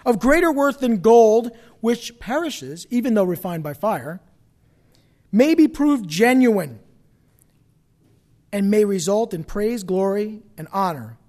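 slow, emphatic Bible reading; scripture proclamation. A narrator's reading. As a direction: read the verse out slowly and forcefully.

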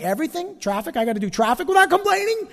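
mock outrage at the rule; mock disbelief. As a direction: protest it like a kid told no. Protesting the verse in disbelief.

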